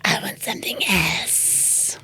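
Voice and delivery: slow, whispered Batman rasp; raspy little nephew. Retold or imitated. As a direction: drag it out in a hoarse toddler whisper. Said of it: "the voice that I do for my dog".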